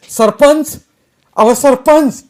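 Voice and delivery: very high, loud calling out; desperate shout. A narrator's reading. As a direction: yell for the village head in panic. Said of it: "या शंकरच्या ओरडण्यानं". (जिवाच्या आकांतानं हाक)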